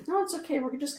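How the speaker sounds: startled reassurance. Real, not acted. Caught off guard during her live reading, startled reassurance. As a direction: brush it off quickly. Sudden reaction, higher voice.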